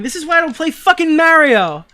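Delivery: high, loud gamer outburst; real rage. Real, not acted. Player swearing off platformers after falling, raging yell. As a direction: yell it in exasperation at the game.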